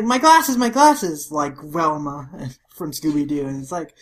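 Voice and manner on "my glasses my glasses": high, loud wail of dismay; mock frantic cry. Shinpachi looking for lost glasses, mock frantic. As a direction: cry out as if groping for them.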